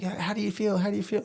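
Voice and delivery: high-pitched, eager reporter voice; put-on high interviewer patter; mock interviewer. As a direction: pester them like a pushy interviewer. Acting out his mock interview.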